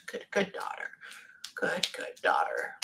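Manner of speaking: barely voiced whisper, very soft. Real, not acted. Medium passing on the spirit's praise in a whisper. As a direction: breathe the words without voice.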